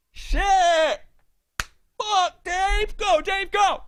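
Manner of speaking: high excited cheers; root for him like a fan at a game; mock cheering. Cheering Dave on from the stands, high excited cheers.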